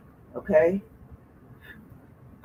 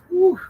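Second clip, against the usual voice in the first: high, loud breathless whew; winded whew; 13 semitones higher, 6 dB louder than usual. Real, not acted. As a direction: blow out the effort. Winded before sit-ups.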